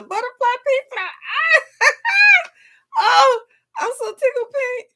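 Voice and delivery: very high, loud, teary delight; laugh-cry with happiness; overwhelmed with joy, near tears; joyful crying. Real, not acted. Surprise gift, crying with joy.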